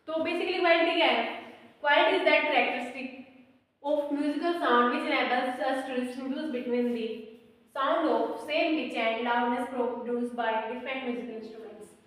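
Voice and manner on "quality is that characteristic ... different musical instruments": slow, deliberate dictation for notes. Real, not acted. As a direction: read it out slowly so they can write. Dictating definition to students. (धीमे-धीमे लिखवाना)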